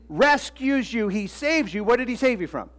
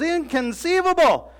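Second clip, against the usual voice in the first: high mock-outraged Inconceivable; Vizzini exclaiming; 8 semitones higher, 3 dB louder than usual. Preacher imitating Vizzini's indignant cry. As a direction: squeal it in disbelief. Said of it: "Vizzini goes".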